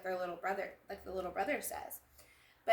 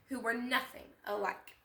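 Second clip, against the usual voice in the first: ordinary delivery, nothing marked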